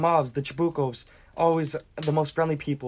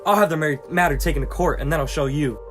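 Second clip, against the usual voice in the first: heated, louder protest; agitated anger; 5 dB louder than usual. Acted. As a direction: argue indignantly with a neighbor. Quarrel, told not to agitate himself.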